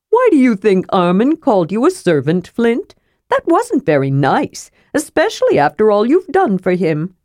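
lively high voice of a curious kender; chatty inquisitive kender. Acted. Kender sympathising with his slighted dwarf friend, high and inquisitive.

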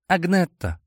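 put-on high squeaky girl's voice. A narrator's reading. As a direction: squeak the name timidly. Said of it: "пропищала та".

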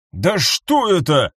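slow, strained exclamation; drawn-out cry of alarm. A narrator's reading. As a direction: call it out in horrified bewilderment. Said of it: "выкрикнул Фокс".

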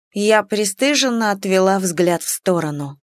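ordinary delivery

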